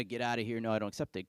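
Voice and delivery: ordinary talk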